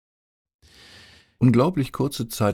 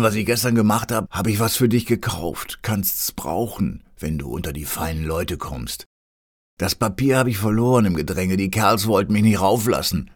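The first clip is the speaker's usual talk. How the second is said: blurted in one breath; 2 semitones higher than usual. A narrator's reading. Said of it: "In einem Atemzuge stieß er den Satz heraus".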